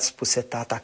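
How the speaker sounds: ordinary delivery, nothing marked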